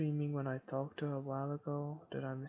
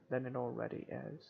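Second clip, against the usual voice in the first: plain reading voice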